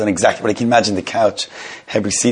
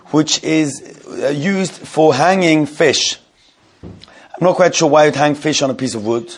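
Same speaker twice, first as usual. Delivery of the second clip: teaching voice; 5 semitones higher than usual. Acted. Starts the halacha text class.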